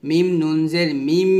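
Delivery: slow spelling chant, last "মিম" held on a humming m; ghunna demonstration. Shown as a demonstration. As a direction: spell it slowly and stretch the final m of "মিম". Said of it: "এভাবে". ("মিম নুন জের মিম", শেষের মিম গুন্নাসহ টেনে)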